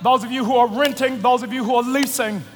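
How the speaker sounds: low soft altar call; prayer voice. Acted.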